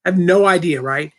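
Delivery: normal talking voice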